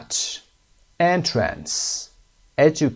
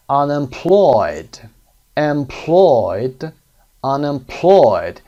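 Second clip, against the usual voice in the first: Unemployed said slowly, loud, stress on -ploy-; slow, loud teacher model: Unemployed; 3 semitones higher, 7 dB louder, much slower than usual. Shown as a demonstration.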